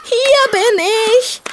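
high, slow hopeful piglet call. Acted. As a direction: call back with hope, high and drawn out. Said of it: "die hoffnungsvolle Stimme".

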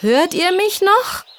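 put-on a boy calling high and slow into the cave, worried; high worried shout into the dark. A narrator's reading. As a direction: call down anxiously, drawn out. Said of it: "rief Jürgen besorgt".

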